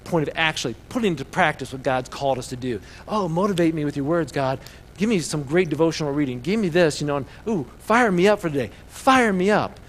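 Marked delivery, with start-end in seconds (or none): 3.1-9.8 higher, louder plea to be fired up; mock eager pleading. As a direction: play the hungry-for-hype believer, building in volume. Mocking believers who only want motivation.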